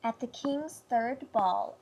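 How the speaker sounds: usual speaking voice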